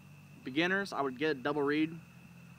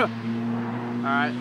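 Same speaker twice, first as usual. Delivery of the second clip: normal talking voice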